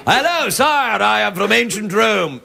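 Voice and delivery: a hint of a slow, stiff English accent; lightly done posh English Roman. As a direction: announce it like a stagey English Roman. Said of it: "have an English accent".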